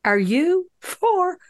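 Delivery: high, slow, incredulous drawn-out question; stunned disbelief. Retold or imitated. Watching a grown man jump on Mourinho, slow high disbelief. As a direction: let the disbelief stretch each word up high. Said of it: "And I was like".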